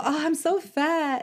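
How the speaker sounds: drawn-out "Oh, I'm so fat"; slow mock whine. Mocking a fishing friend, slow mock whine. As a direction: stretch the complaint slowly. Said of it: "when people go".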